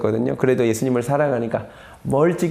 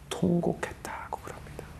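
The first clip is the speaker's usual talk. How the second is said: very soft, breath-only whisper; solemn hush; 4 semitones higher, 14 dB quieter than usual. Real, not acted. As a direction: let the words sink into a whisper. Preacher hushed at Peter's weeping. (숨죽여 속삭이듯)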